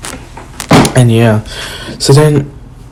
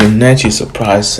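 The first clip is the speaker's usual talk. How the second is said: usual speaking voice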